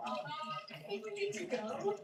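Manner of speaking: retold fright. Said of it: "We were totally freaked out".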